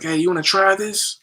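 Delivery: lightly voiced girlfriend offering a bite; imitating girlfriend. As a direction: coax him to try it.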